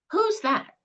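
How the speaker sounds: the mother with Alzheimer's, lost in the question; confused mother. Acted. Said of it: "Brett and Mom in Assisted Living".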